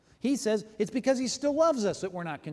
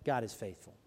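quiet quote; 7 semitones lower, 5 dB quieter than usual. Retold or imitated. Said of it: "this guy stood up and said".

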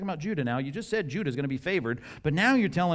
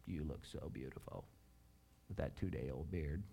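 soft, much quieter playful whisper; whispering in her ear; 10 semitones lower, 17 dB quieter than usual. Pretend. Husband whispering a joke into his wife's ear, hushed. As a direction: lean in and breathe it into her ear jokingly. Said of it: "I'm gonna whisper in her ear".

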